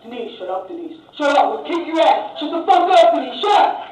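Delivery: barked Long Island husband telling her off; loud, sharp Long Island bickering; Long Island accent. Acted. Husband shutting Denise up on the metro, Long Island accent. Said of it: "they were talking like this".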